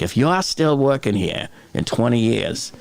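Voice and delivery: imitated thick South Boston accent, r's dropped. Quoting Good Will Hunting to viewers, thick South Boston accent. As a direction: do a movie tough talker from South Boston. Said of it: "South Boston thick accent".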